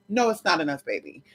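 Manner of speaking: usual speaking voice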